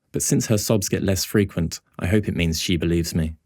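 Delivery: plain reading voice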